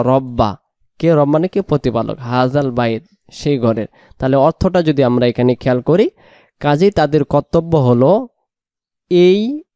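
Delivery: ordinary delivery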